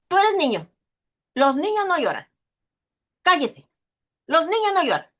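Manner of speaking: mock stern parent telling a boy not to cry; scolding parent. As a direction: bark it at the crying child.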